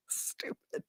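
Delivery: soft whispered self-scolding. Real, not acted. Telling viewers her childhood mistake, whispered. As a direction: breathe it out like a private aside. Self-mocking aside after story.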